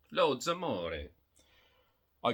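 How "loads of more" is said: wry repeat. Real, not acted. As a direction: accept the odd phrase with a shrug.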